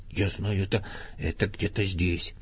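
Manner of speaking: soft, half-whispered muttering; muttering to himself. A narrator's reading. Pooh rummages through his things, muttering softly to himself. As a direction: mumble under your breath while searching. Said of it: "бормотал он себе под нос".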